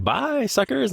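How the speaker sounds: high, playful send-off; mock gleeful farewell. Leaving the nursing home for a new life, mock gleeful. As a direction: toss off a cheeky high-pitched goodbye.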